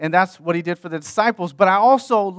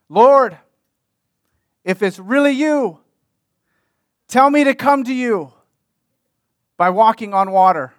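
loud, raised call across a distance; yelling; 3 semitones higher, 6 dB louder than usual. Acted. Peter yelling to Jesus from a storm-rocked boat, yelling. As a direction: call out over the water, voice lifted. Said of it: "Peter is yelling these words".

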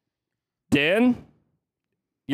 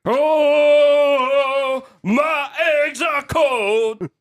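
high, loud belted rocker wail; 10 semitones higher, 5 dB louder, much slower than usual. Acted. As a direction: wail it slowly like an overblown metal singer. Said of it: "I'm gonna be [name]".

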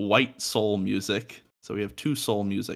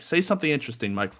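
ordinary talk, nothing marked

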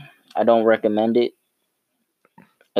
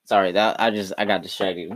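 ordinary talk

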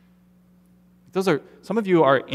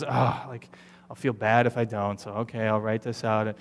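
lower, softer, grudging sigh; guilted sigh; 5 semitones lower, 6 dB quieter than usual. Retold or imitated. A guilted church member grudgingly writing a check, sighing. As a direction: sigh it out like you're caving to guilt.

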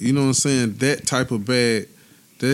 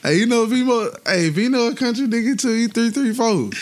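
excited; 11 semitones higher, 3 dB louder than usual. Real, not acted. Excited hyped claim.

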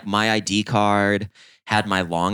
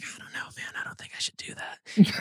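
whispered imitation of a hesitant friend; very soft, voiceless protest; hushed reluctance; 11 semitones higher, 7 dB quieter than usual. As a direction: back away from the idea in a whisper. Reluctant friend hesitates to steal beer.

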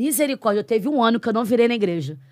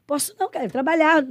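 husband's refusal; 7 semitones higher, 5 dB louder than usual. Retold or imitated.

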